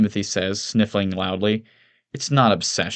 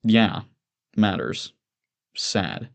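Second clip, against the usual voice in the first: unmoved echo; much slower than usual. Acted. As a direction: repeat it with no investment at all. Bateman echoes without feeling.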